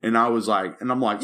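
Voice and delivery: ordinary talk, nothing marked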